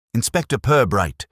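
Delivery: calling out. Acted. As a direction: call his name across the path. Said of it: "she called".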